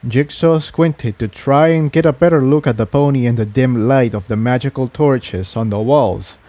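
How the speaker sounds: ordinary delivery, nothing marked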